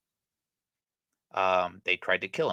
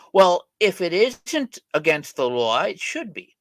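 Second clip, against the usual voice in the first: righteous citizen; 8 semitones higher, 4 dB louder than usual. Retold or imitated. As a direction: pretend to be a stern moralist insisting on the law. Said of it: "another double-digit percentage probably say".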